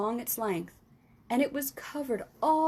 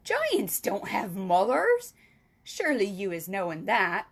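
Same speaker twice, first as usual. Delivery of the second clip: scoffing; 2 semitones lower, 4 dB louder than usual. Acted. Giant scoffs.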